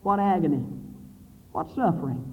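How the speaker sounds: ordinary talk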